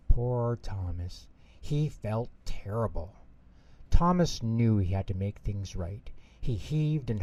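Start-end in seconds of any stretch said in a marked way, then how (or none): none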